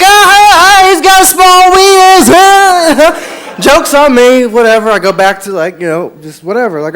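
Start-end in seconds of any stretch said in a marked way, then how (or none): 0.0-3.3 very high, loud, drawn-out jeer; mocking laughter. Whole bar laughing at the comic's expense, mock guffawing. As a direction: imitate a crowd cackling at someone. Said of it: "And everyone's laughing".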